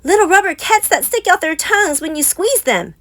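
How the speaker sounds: put-on high childlike voice; excited cub. A narrator's reading. Cubs telling Mama about the rubber cats. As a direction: gush over the toy like a small kid.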